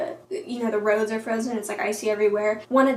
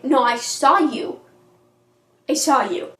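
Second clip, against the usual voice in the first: slow, firm mock accusation, leaning on "saw"; acted insistence; 2 semitones higher, 5 dB louder than usual. Bella confronting Edward after the rescue, acted insistence. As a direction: insist on it as in a retold quarrel.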